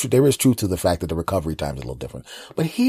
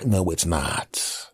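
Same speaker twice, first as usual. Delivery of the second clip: slow rueful correction; 2 semitones lower, much slower than usual. Retold or imitated. Correcting himself after the pain.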